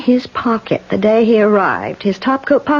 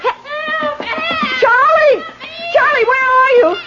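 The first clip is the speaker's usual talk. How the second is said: panicked scream for help. Acted. Landlady screaming for the handyman, panicked. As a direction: shriek for someone to come.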